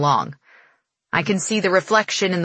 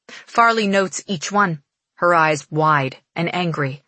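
plain reading voice

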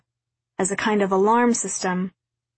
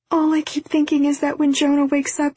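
high-pitched, distressed outpouring; upset confession; 6 semitones higher than usual. A narrator's reading. Daughter confessing to her mother she failed her brother, upset. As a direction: pour out the guilt, pitched high.

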